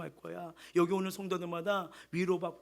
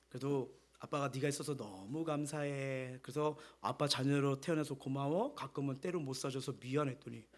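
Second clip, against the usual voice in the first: put-on soft, slowed words of a father to his child; tender father; 5 semitones lower, 5 dB quieter than usual. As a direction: speak gently as a dad blessing his kid.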